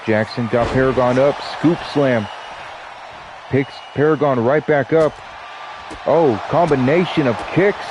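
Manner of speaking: lively ringside announcer calling the action; play-by-play commentary. Acted. Streamer calling the wrestling match for viewers, announcer style. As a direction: call the match like a wrestling commentator, stretching the names.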